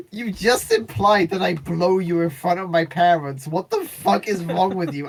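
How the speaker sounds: half-laughing mock anger; mock outrage. Ex scolding the narrator after meeting her parents, mild mock anger.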